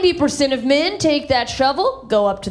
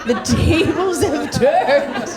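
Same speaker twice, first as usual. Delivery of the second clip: villain gloat; 3 semitones higher, much slower than usual. Pretend. Son walking into his dying dad's room, villainous gloat. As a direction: savor it like a movie villain. Villain line entering hospital.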